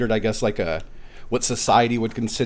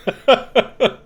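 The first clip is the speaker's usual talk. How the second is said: loud high giggle; giggling at the memory; high laugh; 11 semitones higher, 6 dB louder than usual. Real, not acted. Laughing at own story.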